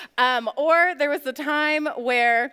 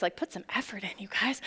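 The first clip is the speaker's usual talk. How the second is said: whisper; 5 semitones lower, 9 dB quieter than usual. Real, not acted. Aside advice to the students.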